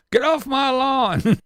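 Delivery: put-on high, slow cranky old geezer; mock a cranky old man yelling at kids; grumpy old man. Mocking bitter old people, grumpy old-man yell. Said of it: "YouTube equivalent of".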